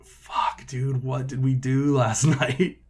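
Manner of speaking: croaky start, then slow drawn-out groaning question; hungover. Acted. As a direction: act out a morning-after hangover, half laughing. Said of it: "you're fucking hungover".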